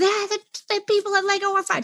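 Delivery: put-on high whiny kid; a kid griping; whining child. Child answering the parent, high whiny gripe.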